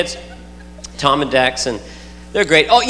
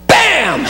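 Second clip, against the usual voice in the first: abrupt, higher-pitched "bam"; sudden outburst; 7 semitones higher than usual. Retold or imitated. Dad imitates four-year-old son's out-of-the-blue outburst, sudden and explosive. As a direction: burst it out from nowhere. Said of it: "Dex will just suddenly, out of the blue, just go".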